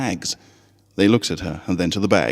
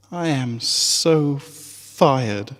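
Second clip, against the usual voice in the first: despairing mutter; 6 semitones higher, much slower than usual. A narrator's reading. Despairing aside to herself.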